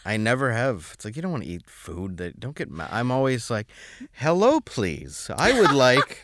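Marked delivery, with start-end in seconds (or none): 4.1-6.2 high, loud, slow grovelling order; exaggerated politeness. Customer afraid of spit in food, hammed-up polite ordering. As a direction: lay the courtesy on thick in a raised voice.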